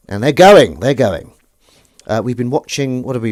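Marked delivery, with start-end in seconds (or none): 0.1-1.3 loud and rising as the water drains; excited cry. Real, not acted. Watching the sink drain, she cheers loudly. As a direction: cheer the dirty water away.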